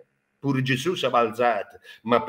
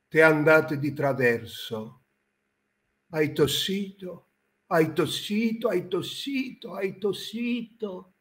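theatrical swelling poetry reading; 3 semitones higher than usual. Acted. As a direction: recite like a grand poet. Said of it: "vorrei recitare la poesia".

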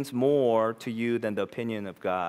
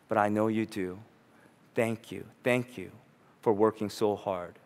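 lower, softer, sincere thanks; tender prayer; 6 semitones lower, 4 dB quieter than usual. Retold or imitated. Believer thanking God the Father, soft and tender. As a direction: say it like a humbled child. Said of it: "You'll instead say".

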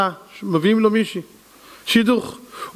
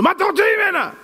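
confronting; 11 semitones higher than usual. Retold or imitated.

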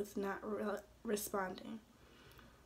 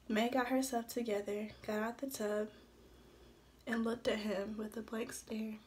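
usual speaking voice

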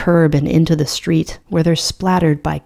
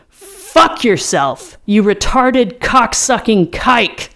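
scream; 5 semitones higher, slower than usual. A narrator's reading. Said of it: "I get up and scream".

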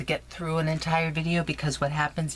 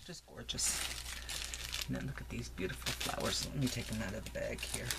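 much softer, admiring murmur; soft awed hush; 6 semitones lower, 12 dB quieter than usual. Real, not acted. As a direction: hush as if not to disturb. Admiring flowers softly.

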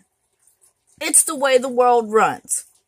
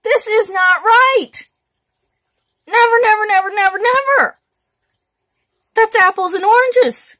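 indignant outburst; 8 semitones higher, 5 dB louder than usual. Retold or imitated. Retorting to a commenter who compared business to Hitler, indignant and loud. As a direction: hammer each "never" as you climb. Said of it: "And I'm like".

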